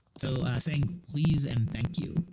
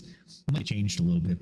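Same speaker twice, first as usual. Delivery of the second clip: ordinary delivery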